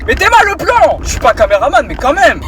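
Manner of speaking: very high, loud complaining; high indignant scolding. Real, not acted. Vlogger scolding the dog for bad framing, high and loud. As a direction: whine at the dog, outraged.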